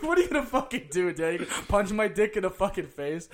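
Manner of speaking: high, loud mock outburst; heated protest. Kid challenging his dad after an autograph snub, heated protest. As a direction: raise pitch and volume in exasperated disbelief.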